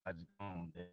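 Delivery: muffled, breaking-up call audio; underwater. Real, not acted. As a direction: sounds like talking under water. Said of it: "it sound like you're underwater".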